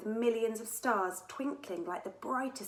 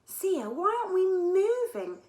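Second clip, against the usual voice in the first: high, loud anxious question; worried child asking why nothing happens; anxious cry to the pilot; 12 semitones higher, 10 dB louder than usual. A narrator's reading. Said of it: "she added anxiously".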